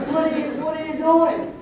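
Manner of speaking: high, loud imitated squeak; squeaky voice. Huge family friend calling out to the farmer, imitated squeaky voice. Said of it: "I can't do the squeaky voice".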